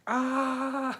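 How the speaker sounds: high mock yelp of pain. Waking up to a burning arm, high yelp.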